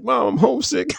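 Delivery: high-pitched homesick whine; mock homesick plea. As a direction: play up a pleading call to mom. Acts out homesick call to mom.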